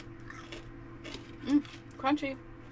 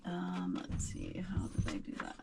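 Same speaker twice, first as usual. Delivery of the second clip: whispered to herself; breathy whisper while thinking; 11 dB quieter than usual. Real, not acted. Muttering to herself at the oven.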